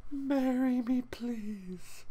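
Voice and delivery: high, breathy whispered plea; pleading to the bed. Pretend. Sleepy man begging his bed to marry him, high whisper. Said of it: "I'm looking at the bed like".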